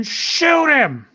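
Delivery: high, drawn-out battle cry; triumphant attack call. Acted. Player announcing the gunslinger's shot, excited cry. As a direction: stretch it out high as you fire.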